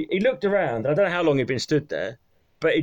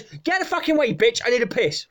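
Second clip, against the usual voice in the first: higher-pitched mock outburst; angry customer; 8 semitones higher than usual. Man demanding the toilet from cleaner, mock anger. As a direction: act out a man losing patience.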